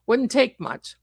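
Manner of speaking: usual speaking voice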